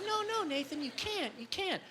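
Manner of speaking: put-on high, soft pleading girl voice. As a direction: whine it like the girlfriend. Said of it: "when I do that girl voice".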